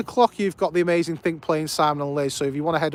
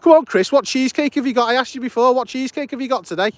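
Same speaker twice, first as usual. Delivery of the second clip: raised, high-pitched pestering; playful badgering; 8 semitones higher, 6 dB louder than usual. Real, not acted. Streamer pestering Chris about today's cheesecake, playful badgering. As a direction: call out loud and high, demanding an answer.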